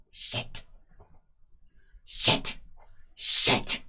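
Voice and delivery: panicked whispered curses. Acted. Thug panicking over botched job.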